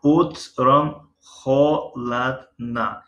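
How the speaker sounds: very slow Russian, stress on Утром; slow repeated teacher demo; slow model reading. Shown as a demonstration. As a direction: read slowly three times for the class. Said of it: "یعنی در پگاهی".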